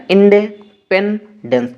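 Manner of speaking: इंडिपेंडेंस split as in-de, pen, dens; broken into chunks. Retold or imitated. Teacher showing a classmate's memory trick, इंडिपेंडेंस broken into chunks. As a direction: say इंडिपेंडेंस piece by piece for spelling. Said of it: "उस तरीके से पढ़ता था".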